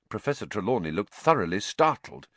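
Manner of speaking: usual speaking voice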